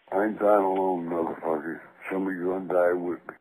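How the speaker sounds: deep, quiet and deadly; low menacing threat. Real, not acted. Caller low death threat.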